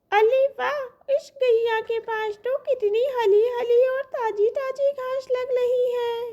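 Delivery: put-on a hungry baby calf eyeing the grass. Calf spotting fresh grass, high and hungry.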